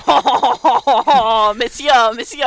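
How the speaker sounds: loud hammed-up French hailing; French accent. Everyone greeting players back in Meslin, loud mock French. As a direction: imitate townsfolk calling out in caricature French. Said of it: "everyone was like".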